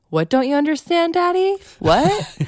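imitating an indignant child, pitched high; mock kid butting in with high, incredulous questions; son parroting parents. Mom retells son chiming in on the fight, high-pitched parroting. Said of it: "parrot things that we were saying".

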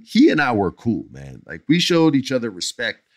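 ordinary delivery

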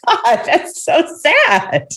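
high, slow, tearful sigh of a quote; half-playful choked-up reaction; mock sad. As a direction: let the words sag as if near tears. Retelling being moved to tears.

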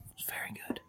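hushed secret whisper. Pretend. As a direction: whisper it like a confession. Whispered aside about quail.

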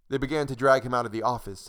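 ordinary delivery

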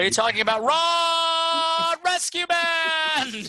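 high, slow excited shout. Real, not acted. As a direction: call it out thrilled. Excited shout about the clip.

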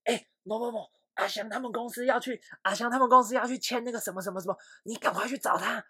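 high, pressing, rushed instructions; urgent orders. Retold or imitated. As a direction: hurry your colleague to beat the rival. Retold urgent call to his sales rep.

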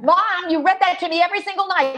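put-on high, fast, insistent little boy voice; small child urging his injured mom; five-year-old boy. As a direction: play the exasperated kid pleading with mom. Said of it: "this five-year-old puts both hands on his hips".

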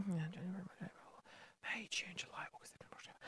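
unvoiced whisper, counting off; whispered list. Real, not acted. Counting remaining contract months, whispered list. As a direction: rattle the months off under your breath. Listing months under breath.

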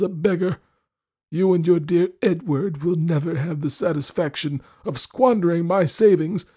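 put-on high, insistent old husband's voice; bullying old lord. Lord threatens his wife over Edward, bullying. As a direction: play a jealous miserly lord laying down the law.

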